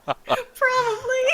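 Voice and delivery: high, slow word through laughter; laughing. Real, not acted. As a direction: admit it while cracking up. Laughing admission after being teased.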